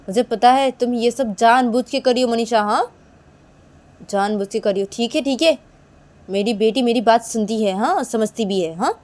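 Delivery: loud, indignant retort; accusing and emphatic; annoyed retort. Acted. Acted bickering with Manisha.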